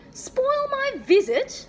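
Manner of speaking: high, loud, slow outraged shriek. A narrator's reading. Father shrieking at the horror, high, loud and outraged. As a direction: explode in disbelief. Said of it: "Dad shrieked".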